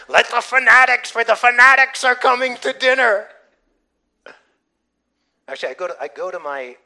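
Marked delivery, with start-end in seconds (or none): 0.1-3.3 high, very loud jeering announcement; mocking shout. Family announcing the believers at dinner, jeering loudly. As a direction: holler it like a derisive herald.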